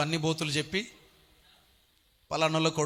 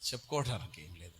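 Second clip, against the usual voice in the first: sheepish mumble; 9 semitones lower, 12 dB quieter than usual. Retold or imitated. Imitates embarrassed congregant.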